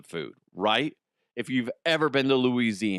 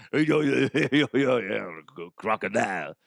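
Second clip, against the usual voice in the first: garbled mock Cajun accent, dropping away; hopeless stab at a Cajun accent; horrible Cajun accent. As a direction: mumble through a badly faked Cajun accent. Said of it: "That's a horrible accent".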